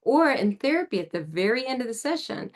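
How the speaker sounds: ordinary talk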